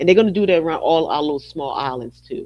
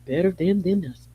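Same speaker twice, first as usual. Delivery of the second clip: ordinary talk, nothing marked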